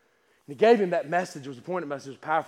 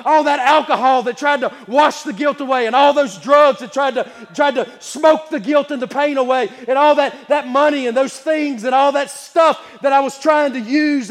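loud, high, driving sermon cadence; full-force preaching; 8 semitones higher, 11 dB louder than usual. Acted. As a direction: preach it with fire.

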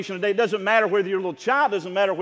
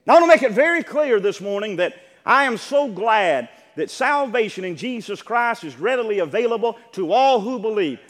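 ordinary delivery

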